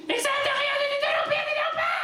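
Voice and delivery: very high, fast shrill scolding; shrieking mother. Retold or imitated. Mother bursting in, screaming at her son.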